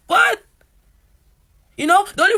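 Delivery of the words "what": very high, loud outraged what; stunned by the unfairness; shocked what. Retold or imitated. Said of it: "I was like".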